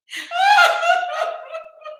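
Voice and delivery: loud shrieking laugh; high laughing shriek. Real, not acted.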